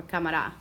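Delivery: "cámara" as ca-ma-RA, low and falling; stress on last syllable. Shown as a demonstration. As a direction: save the weight for RA in "cámara". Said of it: "listen to where we hear the stress".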